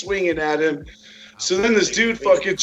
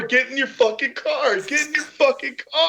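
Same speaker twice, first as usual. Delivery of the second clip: mock frantic order; 10 semitones higher than usual. Imitating Zero ordering the guy.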